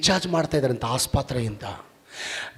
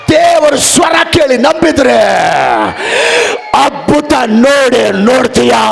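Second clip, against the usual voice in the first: loud, higher preaching; preaching at full force; 8 semitones higher, 10 dB louder than usual. Acted. Preacher rousing the congregation against fate, loud. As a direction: hammer the point home to the church. Faith declarations, hallelujah.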